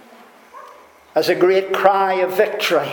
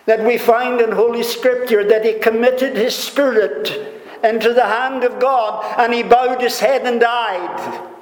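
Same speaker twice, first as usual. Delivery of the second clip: raised, emphatic pulpit voice; impassioned preaching; 7 semitones higher than usual. Real, not acted. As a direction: preach it high and driving, falling at the end. Preacher raising his delivery.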